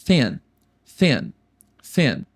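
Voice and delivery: thin, língua no dente, repetido devagar. Shown as a demonstration. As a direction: repita thin com TH na língua. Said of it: "esse T H é com a linguinha no dente". (repetição de thin com a língua no dente)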